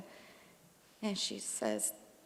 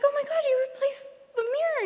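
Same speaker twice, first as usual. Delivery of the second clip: much higher, louder and fast; delighted gasp; 7 dB louder, faster than usual. Retold or imitated. Thanking her dad for the gift, much higher and louder delight. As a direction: squeal it with delight.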